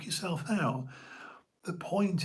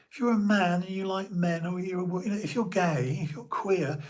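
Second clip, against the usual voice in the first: normal talking voice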